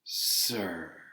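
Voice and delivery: soft, silky prompt; smooth correction. A narrator's reading. Charon correcting the boy, soft and smooth. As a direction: smoothly demand respect. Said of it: "he added smoothly".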